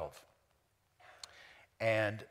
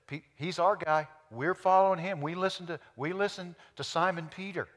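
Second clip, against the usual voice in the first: higher, louder voice of a loyal faction; mock partisan; 7 semitones higher, 8 dB louder than usual. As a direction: speak up as a devoted follower.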